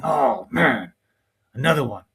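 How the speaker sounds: lightly voiced grumbling detractor; mock exasperated complaint, slightly put on; mock disgruntled critics. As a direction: imitate someone groaning at a betrayal. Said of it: "crackers are probably saying".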